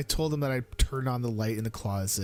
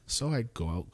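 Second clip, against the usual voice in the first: ordinary delivery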